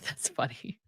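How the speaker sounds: breathy laugh through the words; amused whispered aside. Real, not acted. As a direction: giggle it out softly.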